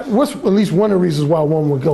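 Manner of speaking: ordinary talk, nothing marked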